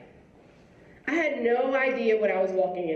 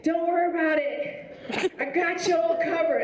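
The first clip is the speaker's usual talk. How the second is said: higher, friendly guard voice reassuring her; security officer promising a ride in the rain, higher and eager; 7 semitones higher than usual. Retold or imitated. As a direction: play the helpful campus guard, pitched up. Guard wipes seats, reassures.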